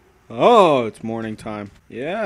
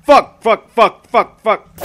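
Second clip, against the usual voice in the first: frustrated cursing; 4 semitones higher, 5 dB louder than usual. Real, not acted.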